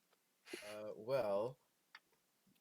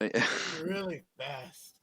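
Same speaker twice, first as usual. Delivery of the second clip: sarcastic; 4 semitones higher, 7 dB louder, much slower than usual. Acted. Mocking a slow walker.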